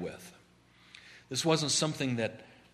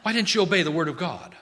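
demanding, higher and louder; Samuel confronting Saul; 5 semitones higher, 5 dB louder, faster than usual. Acted. Samuel rebuking King Saul for disobeying God, loud and demanding. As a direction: confront him like a stern prophet. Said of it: "he says".

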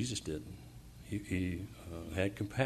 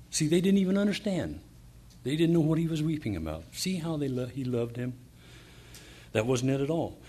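preaching fervor; 4 semitones higher than usual. Real, not acted. Preacher scorns crowd's misunderstanding.

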